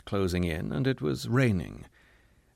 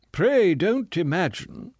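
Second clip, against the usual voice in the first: slow, higher and lofty; a pompous gentleman speaking slowly, higher; very dignified voice; 8 semitones higher, 4 dB louder, slower than usual. Acted. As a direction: draw it out with wounded dignity. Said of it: "said the man in a very dignified voice".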